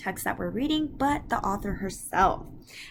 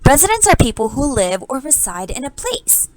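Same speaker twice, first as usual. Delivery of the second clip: louder, brisk teacher voice; teacher addressing the class; 8 dB louder than usual. Real, not acted. Teacher introducing vocabulary to the students, louder and brisk. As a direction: project to the whole class.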